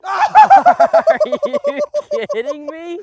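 very high, loud, drawn-out squeal of disbelief; astonished shriek. Real, not acted. Golfer reacting to a backdoor shot, shrieking in disbelief. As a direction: squeal it out, stretched and incredulous.

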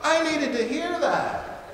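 high, loud and slow, full of feeling; gushing praise. Pretend. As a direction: gush like a moved listener. Gushing congregant, repeated.

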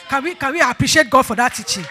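loud, rallying shout; calling for applause. Real, not acted. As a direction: rouse the congregation to clap. Calls congregation to applaud.

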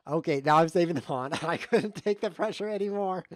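loud, high, breaking into a laugh; laughing confession. Real, not acted. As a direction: blurt it out while cracking up. Gives in, laughing, saves the pawn.

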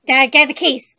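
loud, urgent call; urgent shout. Acted. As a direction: shout the order across the room. Urgent call to get keys.

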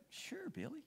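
whispered, choked-up softness; tender whisper. Retold or imitated. To a friend leaving before dawn, a tender whisper. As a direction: barely breathe the words out, moved. Pastor answering a tearful friend goodbye.

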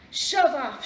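loud, rude storybook shout; abrupt, loud and rude; shouted rudely. A narrator's reading. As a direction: shout a blunt dismissal. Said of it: "shouted the pig rudely".

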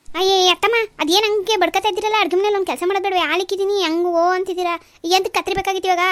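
loud, high, fast scolding back; irritated retort. Acted. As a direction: snap back at the nagging mother-in-law. Daughter-in-law snapping back in irritation.

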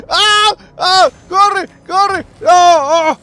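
high, loud frightened cries; panicked yelling. Real, not acted. Gamer fleeing a monster, panicked shouts. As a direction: yell as if running for your life.

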